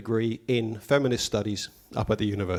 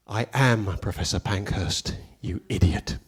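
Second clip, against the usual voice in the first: stern professor; 6 semitones lower, slower than usual. Retold or imitated. Professor snaps back at him.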